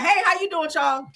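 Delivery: imitating the cast sucking up; mock friendly greeting. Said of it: "They be like".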